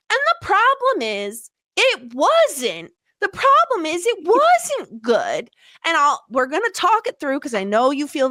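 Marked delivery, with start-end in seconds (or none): 0.1-2.9 high dramatic lead-in. Acted. Dramatic buildup. 3.2-5.5 high, slow, drawn-out verdict; dramatic reveal. Acted.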